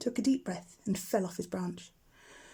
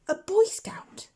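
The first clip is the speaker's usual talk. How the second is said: much higher, slow, whispery wonder; a baby owl in awe of the scout; amazed owlet; 11 semitones higher, 3 dB louder, much slower than usual. A narrator's reading. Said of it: "Plop asks".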